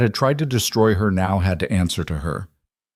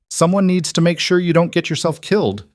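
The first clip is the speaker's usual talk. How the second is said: insistent friend; 10 semitones higher than usual. A narrator's reading.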